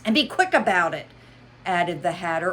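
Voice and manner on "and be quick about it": hurried. Acted. Hatter adds.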